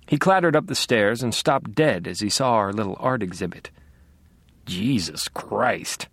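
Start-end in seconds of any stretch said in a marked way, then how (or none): 4.7-6.1 slow, stunned low curse; shocked oath. A narrator's reading. Captain seeing the altar, stunned and slow. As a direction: let the shock drain out of it.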